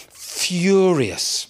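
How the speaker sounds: ordinary delivery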